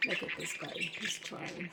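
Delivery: hushed whisper while watching. Real, not acted. Owner whispering while watching her bird, hushed. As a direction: breathe it so the bird isn't startled.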